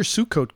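"suit coat" stressed on the first syllable; wrong syllable stressed. Real, not acted. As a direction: say "suit coat" like two English words. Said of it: "got the emphasis on the wrong syllable".

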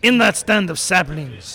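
put-on lightly French-accented reading; French character voice. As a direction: read it with a fake French accent. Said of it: "another voice we can't understand".

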